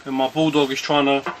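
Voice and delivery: normal talking voice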